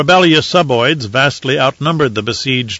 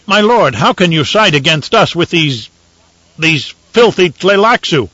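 raised, indignant outcry; angry protest; 6 semitones higher, 4 dB louder than usual. Acted. Ambassador protesting to the Emperor, outraged. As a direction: let the outrage spill over. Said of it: "Pilru flushed with anger".

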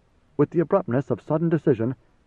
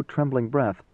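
usual speaking voice

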